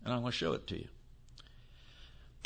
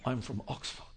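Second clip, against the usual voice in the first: mock Oxford snob; 6 semitones lower, 10 dB quieter than usual. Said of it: "you ever throw".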